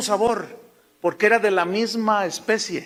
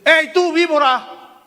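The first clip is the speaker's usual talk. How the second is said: slow, loud, high shout; preacher acting John the Baptist shouting at the crowd; 9 semitones higher, 8 dB louder, slower than usual. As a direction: bellow it at the crowd like a prophet. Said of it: "es que le hago así".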